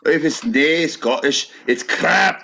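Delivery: put-on Scottish accent, rising high at the end. Mocking the Scottish slogan in a Scottish accent, high. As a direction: deliver it like a proud Scot.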